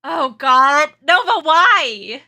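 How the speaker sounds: slow, loud why; loud drawn-out whine. Real, not acted. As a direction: wail it out in disbelief. Exasperated loud plea.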